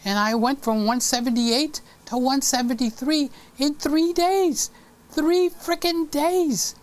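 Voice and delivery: bragging about losing five pounds fast; excited. Real, not acted. Amazed at quick weight loss.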